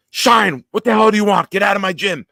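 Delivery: high, loud barking yell; coach yelling. Retold or imitated. Cheer coach yelling at a kid who barged into practice, loud high yell. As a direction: blast him out of the gym like a drill coach.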